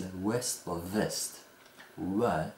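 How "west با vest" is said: west with rounded w, vest with teeth on lip; w versus v contrast. Shown as a demonstration. Teacher contrasts two words by their first sound, slowly. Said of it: "مثل".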